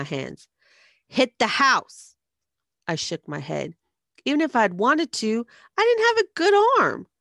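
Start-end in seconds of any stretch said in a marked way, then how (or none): 1.1-2.1 loud, slow, weighty command; forceful order. A narrator's reading. Bully shoving an egg into her hands, loud slow command. 4.3-7.1 building from raised voice to high, loud cry; desperate protest. A narrator's reading. Frightened girl refusing to throw, protest rising to a cry.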